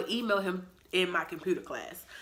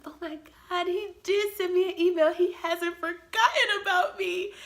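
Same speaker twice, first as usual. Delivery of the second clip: overjoyed gush; 12 semitones higher, 6 dB louder than usual. Retold or imitated. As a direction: gush with relief that he wrote. Reading his email at last.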